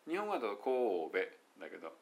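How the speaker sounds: ordinary delivery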